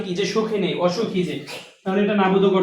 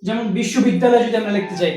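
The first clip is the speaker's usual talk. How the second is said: ordinary delivery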